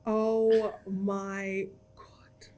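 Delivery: drawn-out, breathy whisper of shock; awestruck, hushed and stretched out; stunned disbelief. Retold or imitated. Retells seeing the viral video.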